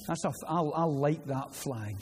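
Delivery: normal talking voice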